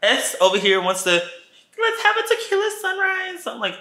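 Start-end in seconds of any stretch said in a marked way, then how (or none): 1.1-3.4 put-on girly request. S's drink request quoted.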